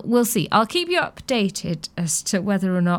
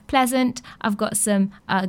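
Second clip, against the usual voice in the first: ordinary talk, nothing marked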